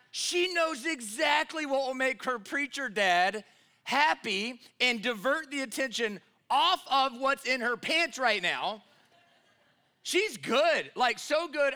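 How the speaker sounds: high, loud, slow amazed telling; excited exclamation. Real, not acted. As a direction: marvel at the clever daughter. Excited punchline to congregation.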